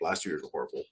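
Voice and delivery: ordinary talk, nothing marked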